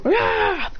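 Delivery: short high mock roar; animal attack roar. Animals charging the soldiers, acted roar.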